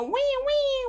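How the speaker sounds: high-pitched toy weeping; pretend crying. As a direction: squeal a make-believe little "wee" cry. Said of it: "you go".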